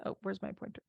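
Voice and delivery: usual speaking voice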